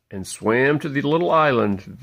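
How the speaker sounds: ordinary delivery, nothing marked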